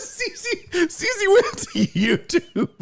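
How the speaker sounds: high, squeaky talk through laughter; helpless laughter. Real, not acted. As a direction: barely get it out for laughing. Cracking up at the chat.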